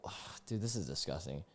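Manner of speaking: whispered, appalled ugh and mutter; disgusted whisper. Real, not acted. To the viewers, horrified by a comment: a whispered groan. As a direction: let the disgust drain your voice to breath.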